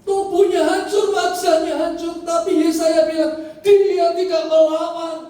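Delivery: high, loud, drawn-out cries; full-force preaching. Acted. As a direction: proclaim the suffering at full intensity. Preaching climax on the crucifixion. (khotbah klimaks, lantang dan melengking)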